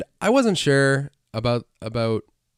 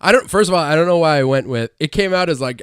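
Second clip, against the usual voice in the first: normal talking voice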